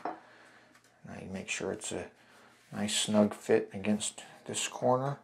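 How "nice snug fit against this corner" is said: normal talking voice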